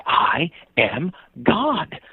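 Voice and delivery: very slow, high, solemn declaration; mock earnestness. Boy tells cyclist he's a deity, imitated solemn earnestness. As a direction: space out each word with great earnest weight. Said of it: "looked at me again with earnestness".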